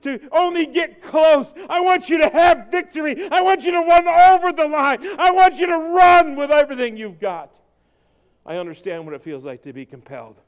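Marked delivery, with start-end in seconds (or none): 0.0-7.5 high, loud rallying preacher cry; preaching at full force. Acted. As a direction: drive the congregation forward, full voice.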